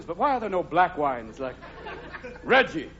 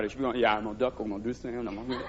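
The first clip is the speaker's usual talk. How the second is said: low, much softer fighter psyching himself; muttering prize fighter; 9 semitones lower, 7 dB quieter, much faster than usual. Retold or imitated. Comic as a prize fighter psyching up, low muttering. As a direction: mutter your plan to yourself in the ring. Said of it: "you feel like a prize fighter".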